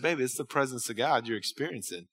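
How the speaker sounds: soft, low motherly reassurance; quiet, smiling tenderness; proud, tender mother. Retold or imitated. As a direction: gently imitate a proud mom comforting her child. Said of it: "proudest smile on her face".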